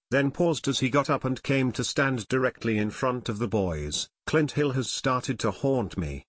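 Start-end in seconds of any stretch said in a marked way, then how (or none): none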